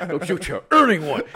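high, loud, strained monster voice; hammed-up threat, pushed up high and loud; mock monster threat. Podcaster jokingly voices monster threatening man mid-act, mock monster threat.

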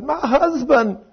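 high-pitched mock woman's voice; imitated aggrieved woman. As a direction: quote her in a high voice. Imitates woman naming the culprit.

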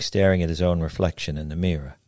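ordinary delivery

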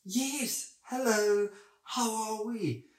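very high, sugary pet-greeting voice; high-pitched baby talk for animals; gushing vet voice. Retold or imitated. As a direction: coo a greeting to a patient, way up high. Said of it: "Why are you speaking like that?".

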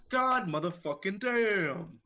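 drawn-out hype catchphrase; Chester Stone catchphrase. Retold or imitated.

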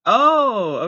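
a sudden loud, high "Oh"; jolted by what's on screen; startled oh. Real, not acted. Vlogger startled by the dashcam clip, loud high oh. Spotting something in the video.

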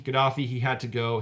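ordinary delivery

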